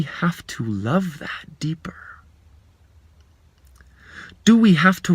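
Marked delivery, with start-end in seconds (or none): 0.0-2.0 whisper. Real, not acted. Soft turn toward love.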